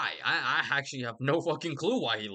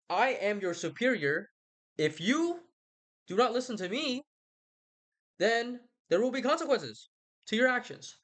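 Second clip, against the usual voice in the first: high-pitched, mock-authoritative warning; stern superior; 9 semitones higher than usual. Superior warning a rebel of consequences, mock stern. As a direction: lay down the law in a raised, put-on voice.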